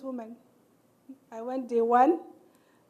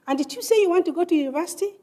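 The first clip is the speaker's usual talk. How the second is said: incredulous question; 7 semitones higher, 5 dB louder, faster than usual. Retold or imitated.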